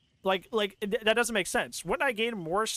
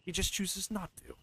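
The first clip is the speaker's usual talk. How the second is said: hushed, softer quote; retold doctor's line; 7 dB quieter than usual. Quoting the doctor on a silent killer, hushed. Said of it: "Doctor Sartain literally goes".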